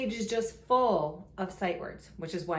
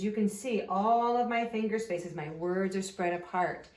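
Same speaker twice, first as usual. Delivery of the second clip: ordinary talk, nothing marked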